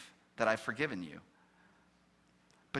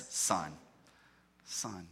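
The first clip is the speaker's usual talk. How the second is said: tender; 8 semitones lower, 4 dB quieter than usual. Acted. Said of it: "has not felt like a son".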